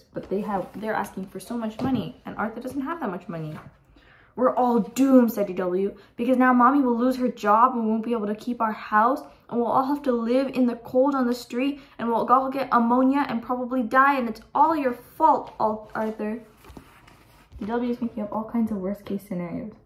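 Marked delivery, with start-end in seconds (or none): 4.3-5.3 loud, overblown announcement of doom; dramatic doom. Acted. Little sister panics over broken computer, dramatic. Sister foretells family disaster. 6.2-16.4 put-on loud, escalating kid tirade; dramatic rant. Little sister blames Arthur for everything, ranting.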